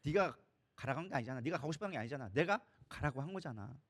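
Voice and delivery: low, soft, fatherly-calm reasoning; God's gentle reassurance. Acted. God telling Joshua not to fear, gentle reassurance. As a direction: settle them down, quietly and firmly. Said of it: "하나님이 얘기하는 거예요".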